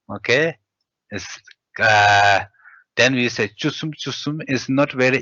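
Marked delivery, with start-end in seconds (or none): none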